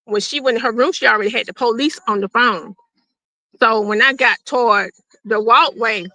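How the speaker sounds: ordinary talk, nothing marked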